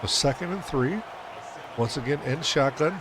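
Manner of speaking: ordinary delivery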